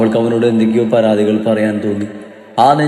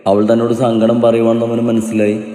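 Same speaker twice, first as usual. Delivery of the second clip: ordinary delivery, nothing marked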